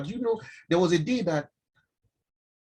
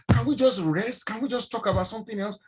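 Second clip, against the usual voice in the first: high-pitched acted pleading; fed-up passenger, reenacted; exasperated plea; 7 semitones higher than usual. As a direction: beg the complainer to give it a rest. Weary passenger pleading with complainer.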